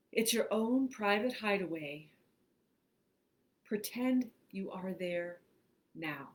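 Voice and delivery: measured, spacious reading with long pauses; guided imagery meditation. Acted. Host leads listeners into imagined woods, guided meditation.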